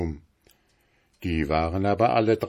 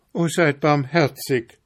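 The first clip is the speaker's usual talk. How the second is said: higher begging cry; landlady pleading for her life; 8 semitones higher, 4 dB louder than usual. A narrator's reading. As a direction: beg for mercy. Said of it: "rief flehend die Wirtin".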